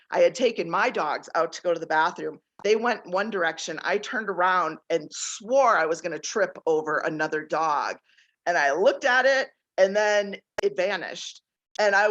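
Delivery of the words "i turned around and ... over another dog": ordinary talk, nothing marked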